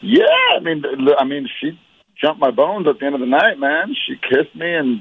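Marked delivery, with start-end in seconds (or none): none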